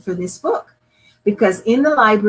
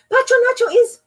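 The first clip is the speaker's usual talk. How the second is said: put-on high, hurried child voice; urgent child; 4 dB louder, much faster than usual. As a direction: blurt it out in alarm. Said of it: "he gasped".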